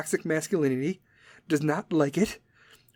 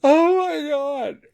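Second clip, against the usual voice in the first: put-on much higher, slow, overwrought teen wail; dramatic teen; 11 semitones higher, 5 dB louder, much slower than usual. Ending the teen impression with a slow, high gasp of despair. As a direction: drag it out like a melodramatic teenager.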